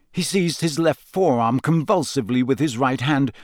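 plain reading voice

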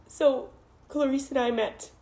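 tearful voice rising high, breaking into laughter; real tears mixed with embarrassed laughs; crying, half laughing. Real, not acted. Telling the group how two friends met, crying and laughing. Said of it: "I don't know why I'm crying".

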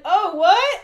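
surprised. Retold or imitated. Retold surprised reaction.

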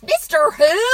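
put-on loud, high boyish question. A narrator's reading. Boy interrupting dad's hushed story, loud voice. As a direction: burst out loudly, pitched well up. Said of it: "asked in a loud voice".

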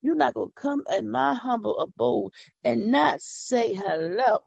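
ordinary talk

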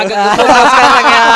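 imitating an intrusive loud greeter; loud retold greeting. Retold heckler's greeting during punchline.

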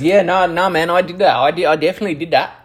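hoger en luider, spottend fake-eerlijk; mocking abuser voice. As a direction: quickly rattle off a sarcastic fake confession. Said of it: "What kind of abuser goes".

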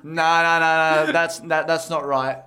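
loud, stubborn repeated nah; loud jokey denial of the bill; loud mock denial. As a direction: refuse it loudly, half joking. Mock denying bill again.

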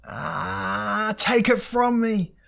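high, drawn-out scream of agony; screamed plea. A narrator's reading. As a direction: scream slowly, building to a loud peak.

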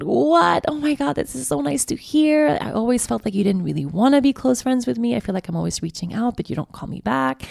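high, surprised gush of friends hearing good news; surprised delight. Retold or imitated. Friend replying to a heartfelt message, surprised and delighted. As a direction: squeal out a happy, startled reply. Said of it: "immediately went".

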